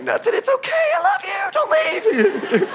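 hammed-up, very high-pitched wailing; mock crying. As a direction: squeal it like a comically weeping dad. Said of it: "like, crying over my boys".

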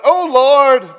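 high, loud call to God; hammed-up loud plea; pleading cry. A narrator's reading. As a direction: cry out in theatrical desperation. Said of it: "they pleaded".

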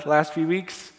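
ordinary talk, nothing marked

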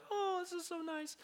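high, soft dreamy sigh of contentment; airy, very high and hushed, blissfully unaware; contented cooing. Retold or imitated. Youth leader admiring a lakeside picnic, contented cooing. Contented before danger is noticed.

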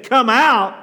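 a booming call to every dead soul; loud command. Retold or imitated. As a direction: call "Come out" out hard and high, like a command. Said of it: "If he would have said".

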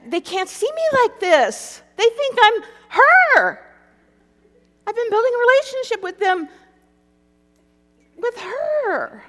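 hammed-up despair rising to a wail; desperate wail. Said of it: "just out of sheer desperation".

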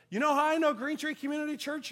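high, loud, eager bystander; eager announcement. Retold or imitated. Bagger excitedly tells pastor how he knows the church.

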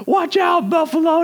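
imitated panicked scream. Brother screaming a warning at the driver near a buffalo, imitated scream. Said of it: "he just screams".

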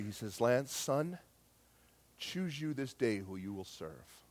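low, very soft, solemn retelling; hushed divine voice. Retold or imitated. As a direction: speak gently and low, as God to a son. Retells God speaking gently, clearly.

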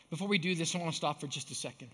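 ordinary talk, nothing marked

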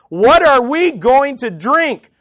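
loud, high, drawn-out demand. Acted. The people turn on Moses over water, demanding. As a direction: throw it out as a slow, forceful demand. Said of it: "they demanded".

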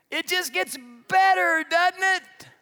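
high, loud, gleeful shout; mock enthusiasm. Preacher teasing the congregation about a dead end, mock enthusiasm.

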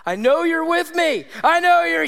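put-on high, pleading child's prayer; imitated cry praying. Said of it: "she was cry praying".

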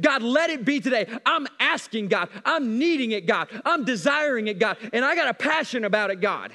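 fervent, driving preacher's prayer; passionate prayer. Shown as a demonstration. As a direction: plead with God, insistent and full-voiced. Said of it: "I get this passionate about it".

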